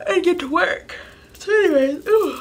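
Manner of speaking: talking through a yawn; words stretched by a yawn. Real, not acted. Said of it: "sorry for yawning".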